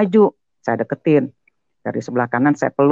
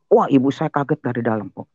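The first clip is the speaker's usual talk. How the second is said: ordinary talk, nothing marked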